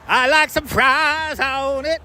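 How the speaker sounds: high, slow, drawn-out fries line; hammed-up slow customer. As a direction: stretch it out like a goofy customer. Voicing customer answering.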